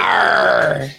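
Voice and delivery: low growling pirate Arr. Retold or imitated. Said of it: "He kinda talked like a pirate".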